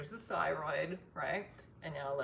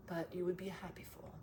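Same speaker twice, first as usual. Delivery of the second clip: whispering; 6 dB quieter, faster than usual. Real, not acted. Whispered joke aside.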